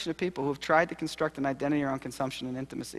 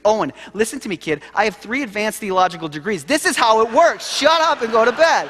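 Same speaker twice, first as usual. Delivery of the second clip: rising to a loud, higher, fed-up shout; exasperated dad; 7 semitones higher, 8 dB louder than usual. Retold or imitated. Father snapping at his son to go to bed, exasperated shout. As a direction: lose it with a kid who won't sleep.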